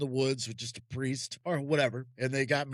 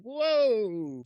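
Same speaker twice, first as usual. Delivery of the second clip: surprised whoa; 8 semitones higher than usual. Retold or imitated. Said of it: "He's like".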